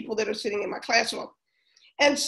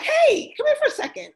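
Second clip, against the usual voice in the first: much higher, bright call across the room; calling the girl over; 12 semitones higher than usual. Retold or imitated. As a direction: call her over in a high voice. Teacher calls girl over.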